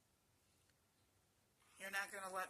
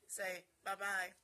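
normal talking voice

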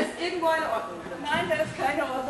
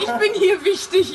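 mock self-important; 4 semitones higher, 7 dB louder, slower than usual. Real, not acted. Claims importance, laughing.

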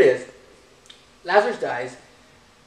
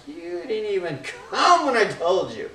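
higher, louder imitation of crying; mock tearful reproach; 4 semitones higher, 6 dB louder than usual. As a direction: play a weeping mourner scolding, half hammed-up. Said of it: "you're crying, you're like".

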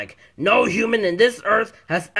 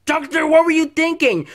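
loud, high-pitched reproach; shocked scolding; 11 semitones higher, 7 dB louder than usual. Acted. Bystander to doctor after the punch, shocked scolding. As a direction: cry out in outrage, high and loud.

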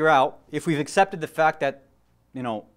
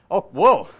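louder startled whoa; surprised; 2 semitones higher, 7 dB louder than usual. Real, not acted. Preacher surprised at how many saw The Matrix, startled. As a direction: react to the crowd's raised hands.

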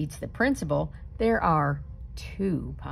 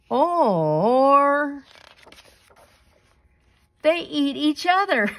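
gleeful twist; 10 semitones higher, 8 dB louder, much slower than usual. A narrator's reading. Comic twist ending.

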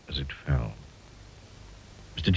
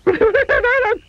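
terrified squeal; 4 dB louder than usual. A narrator's reading. Mister Jelly terrified.

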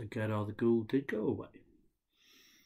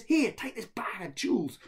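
higher, louder brother voice; brother's voice; 9 semitones higher, 7 dB louder than usual. Acted. Brother giving Ahmed gold, high voice. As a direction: hand it over eagerly.

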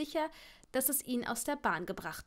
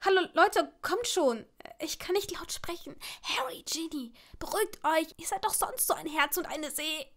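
urgent, higher half-whisper; hushed pleading; 6 semitones higher than usual. A narrator's reading. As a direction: try to calm a quarrel without being overheard. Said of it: "die beiden zu beruhigen".

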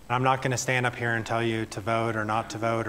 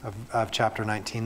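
ordinary delivery

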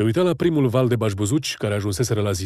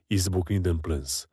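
usual speaking voice

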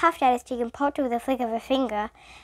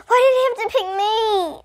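high, loud moan of complaint; plaintive, high-pitched wail; 11 semitones higher, 8 dB louder than usual. A narrator's reading. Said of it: "Stephen moaned".

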